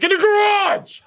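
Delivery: high, sudden yelled repeat; blurted high-pitched holler; exasperated yell. Retold or imitated. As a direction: yell it as if they didn't hear you. Repeats answer after she says What.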